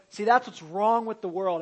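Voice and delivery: loud, pointed mock of a self-righteous believer; smug mock quote. Preacher mimicking judgmental believers to his congregation, loud and smug. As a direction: jab the finger at the world, loud.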